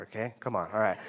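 ordinary delivery

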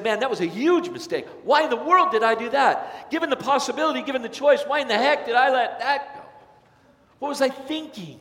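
why did I do that, in frustration; self-reproach. Retold or imitated. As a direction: kick yourself over a huge mistake. Regretful person scolding themselves.

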